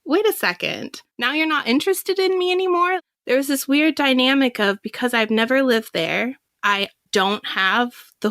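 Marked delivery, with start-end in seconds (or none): 0.0-3.0 higher-pitched, quick acted objection; imitated affronted reaction; mock indignant protest. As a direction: play at being put out, voice climbing into a question. Acted protest at being dropped.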